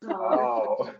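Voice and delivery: ordinary talk, nothing marked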